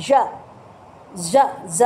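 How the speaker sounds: ঝ said as a buzzing zh sound, held; teacher isolating the ঝ zh sound; sound demo. Shown as a demonstration. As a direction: model the ঝ sound as a voiced zh buzz. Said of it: "এইটা হচ্ছে sound".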